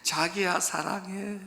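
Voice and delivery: slow, soft "자기야 사랑해", only lightly put on. Preacher first quoting Seoul men's proposals, slow and softly put on. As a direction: drawl "자기야" gently, a hint of a lover. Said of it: "서울 남자들이 어떻게 프로포즈 하는지".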